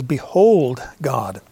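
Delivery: Behold drawn out slowly and leaned on; slow, weighty "Behold God"; emphatic behold. Shown as a demonstration. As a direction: stretch Behold to grab attention. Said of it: "behold is an emphatic".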